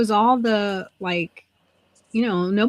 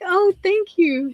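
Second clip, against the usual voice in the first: high, drawn-out relief; slow mock-tearful gush; gushing thanks; 10 semitones higher, slower than usual. Patient thanking nurse for skipping the questions, gushing.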